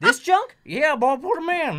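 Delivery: put-on high, loud bayou cook with a thick accent; thick Southern accent. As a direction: garble it like a Southern chef. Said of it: "a real thick accent or something".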